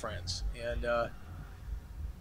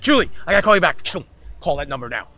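fast, loud rush off the phone; hurried and urgent; 9 dB louder, faster than usual. Retold or imitated. Rushing his girlfriend off to call back, hurried. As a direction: hang up in a frantic hurry. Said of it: "I said".

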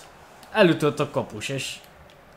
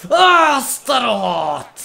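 frustrated shout; 8 semitones higher, 6 dB louder, much slower than usual. Real, not acted. Missed chance.

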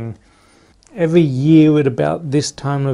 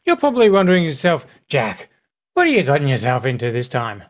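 Jacksepticeye impression; 3 dB louder, faster than usual. Vlogger imitates Jacksepticeye's video line, quick mock Irish. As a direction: rattle it off like an excited YouTuber. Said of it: "it's nothing like it".

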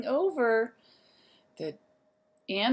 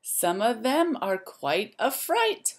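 lively, sing-song storybook voice; reading to children; 2 semitones higher, 4 dB louder than usual. A narrator's reading. Teacher reading a rhyme book to the class, lively and sing-song. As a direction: wake the zoo up like a bright morning call.

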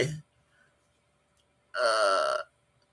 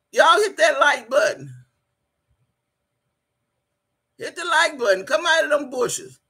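calling out; 7 semitones higher than usual. Real, not acted. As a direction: holler at viewers to press the button. Urging viewers to hit like.

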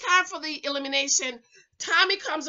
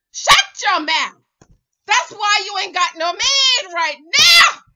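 loud, emphatic telling-off rising high; scolding shout; 5 semitones higher, 10 dB louder than usual. Acted. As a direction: shout down the imagined commenter. Shouts back at imagined commenter.

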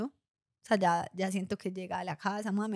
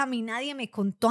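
ordinary talk, nothing marked

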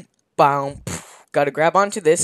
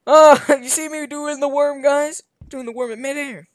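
put-on old man voice; 11 semitones higher, 4 dB louder than usual.

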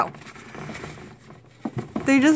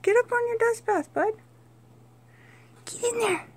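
high coaxing pet voice; 11 semitones higher, 4 dB quieter than usual. Real, not acted. Coaxing chinchilla onto bath.

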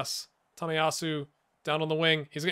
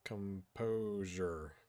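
muttered plea; 7 semitones lower, 14 dB quieter, much slower than usual. Real, not acted. Muttered after counterattack.

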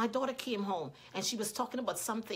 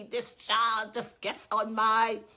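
slow, louder mock exasperation; mad parent, mimicked; 6 dB louder, much slower than usual. Parent complaining about a child, mock anger. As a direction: drag it out like a fed-up parent. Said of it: "We all get mad".

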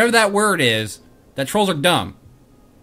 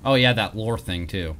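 ordinary delivery

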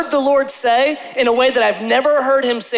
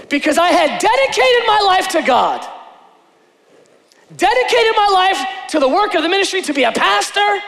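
high, loud, pleading cry; preaching shout; 10 semitones higher, 3 dB louder than usual. Real, not acted. Pastor crying out to his congregation, loud and high. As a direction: preach it out at full force.

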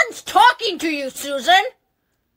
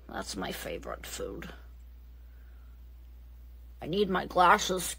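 put-on old lady voice; 7 semitones lower, 12 dB quieter than usual. Old lady muttering alone.

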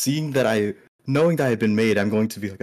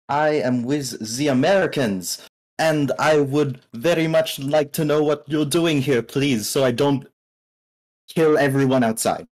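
put-on slow, deliberate German accent; measured threat in a German accent. Player telling the scientist to explain himself, deliberate German accent.

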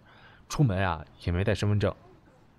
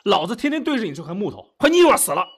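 high, heated ranting; jealous tirade; 6 semitones higher, 4 dB louder than usual. Acted. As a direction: rant out all your pent-up frustration. Jealous rant in quarrel.

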